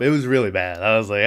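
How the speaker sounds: ordinary delivery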